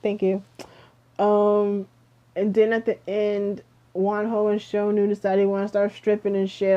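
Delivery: normal talking voice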